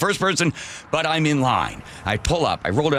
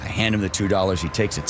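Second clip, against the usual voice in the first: plain reading voice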